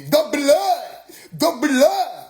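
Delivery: high, wailing mock exorcism; hammed-up horrified pastor; panicked pastor. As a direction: cry out against the devil, words stretched. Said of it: "anytime I asked a pastor".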